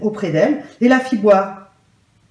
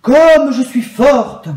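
slow, much louder and higher proclamation; deep sonorous voice; 4 semitones higher, 7 dB louder, much slower than usual. A narrator's reading. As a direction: proclaim it slowly, big and strong, like a mighty cloud. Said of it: "d'une voix devenue plus profonde et sonore".

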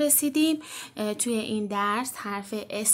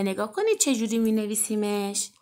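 ordinary talk

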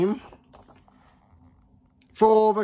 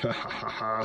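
low, sneaky evil chuckle; short mischievous villain laugh; villain's snigger; 3 semitones lower, 7 dB quieter than usual. A narrator's reading. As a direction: snigger softly to yourself like a schemer. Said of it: "sniggered Evil Pea".

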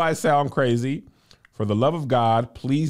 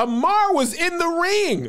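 excited high voice. Real, not acted. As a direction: gush it up high.

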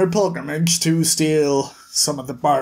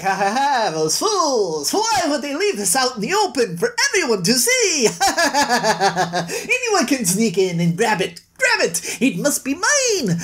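cackling little villain, sneering at fools; gleeful scheming thief with a laugh; hammed-up cartoon villain cackle; gloating thief; 5 semitones higher than usual. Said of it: "And he's like".

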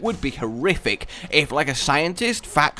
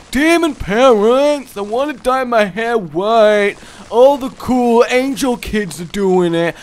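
put-on higher, louder bratty teen whine, slow; whiny demon kid; 8 semitones higher, 6 dB louder, slower than usual. Demon kid begging his parents to dye his hair, bratty whine. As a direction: plead like a sulky teenager. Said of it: "the demon kid and his bad life choices".

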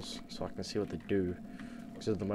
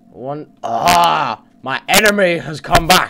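loud high yell at the enemy; 7 semitones higher, 17 dB louder, slower than usual. Real, not acted. Gamer yelling as his enemy returns. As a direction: shout the alarm.

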